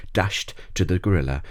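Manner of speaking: ordinary delivery, nothing marked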